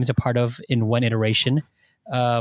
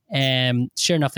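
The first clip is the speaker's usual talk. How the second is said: ordinary talk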